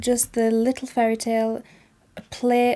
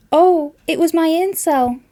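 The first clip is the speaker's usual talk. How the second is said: lightly voiced little fairy girl, higher; a hint of a small child answering; daughter's voice; 7 semitones higher, 6 dB louder than usual. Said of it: "answered the daughter".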